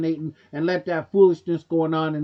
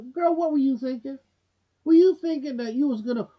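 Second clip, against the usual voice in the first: higher, sassy mock scold of Meghan; 6 semitones higher than usual. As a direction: play the finger-pointing critic, needling her. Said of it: "people even pointing the finger at Meghan, go".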